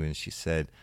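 ordinary talk, nothing marked

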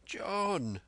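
high, urgent call of a name; sudden cry. A narrator's reading. Tom crying out to Silver in alarm.

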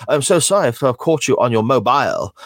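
silly voice. Retold or imitated. Host imitates a sitcom actress for his co-host, silly put-on voice. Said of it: "she's got a silly voice".

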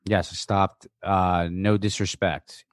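ordinary talk

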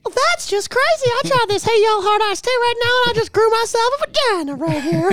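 squeaky high woman's voice; high falsetto voice. Pretend. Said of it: "you got a little bit of a sex change".

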